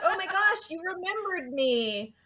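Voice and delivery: high, breathless starstruck gush; mock-excited schoolgirl squeal; Molly gushing. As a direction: swoon over being remembered. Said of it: "she's like".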